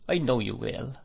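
ordinary delivery

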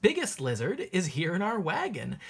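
usual speaking voice

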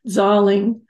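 imitating mother's European accent. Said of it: "I'm gonna do it in her accent".

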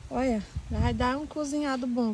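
usual speaking voice

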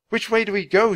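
high, loud urgent question; shout. A narrator's reading. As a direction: shout for directions over the wind. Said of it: "shouted Annie".

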